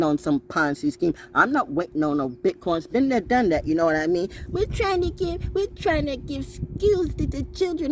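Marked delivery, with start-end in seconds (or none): 4.5-7.9 high, slow put-on do-gooder voice; mocking a celebrity's sweet sales pitch, high and slow; mock charity voice. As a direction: ham up a saccharine charity pitch. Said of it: "That sounds like some more Oprah schemes".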